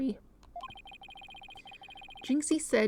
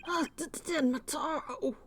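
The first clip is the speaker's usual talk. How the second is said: startled stammer; 4 semitones higher than usual. Acted.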